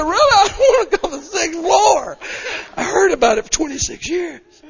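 high, loud wailing plea; mock crying shout. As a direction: wail it out like a panicked child.

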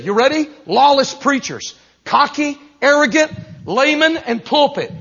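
high, driving pulpit delivery; full-force preaching. Real, not acted. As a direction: hammer each point home to the congregation.

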